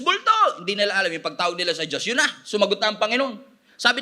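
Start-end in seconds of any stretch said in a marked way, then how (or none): 0.0-0.6 very high cry of alarm; frightened calling out. Acted. Preacher mimics disciples calling on God, frightened high cry. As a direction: call out desperately for help. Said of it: "Tawag sila ng tawag".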